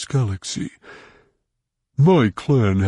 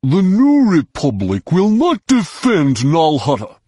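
higher and louder, booming fury; thundering Hutt; 6 semitones higher, 5 dB louder than usual. A narrator's reading. Hutt thundering at Jason about Nal Hutta. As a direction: thunder it at him. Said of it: "Rhonda thundered".

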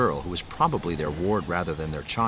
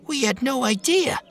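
put-on high, loud nervous young man; 4 dB louder than usual. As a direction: squeak it out like a startled kid. Post voiced again.

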